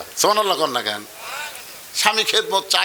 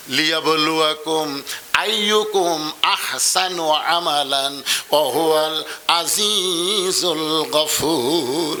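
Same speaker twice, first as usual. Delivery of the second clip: slow, low chanted recitation; Quran recitation; 4 semitones lower, much slower than usual. Acted. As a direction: draw out each word as recitation.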